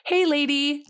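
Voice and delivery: high, playful plant voice; talking plant. Acted. Lungwort greeting a sick hiker, playful high voice. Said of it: "that lungwort is just like".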